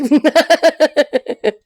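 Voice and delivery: loud, high peal of laughter. Real, not acted. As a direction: burst out laughing. Laughs after saying be happy.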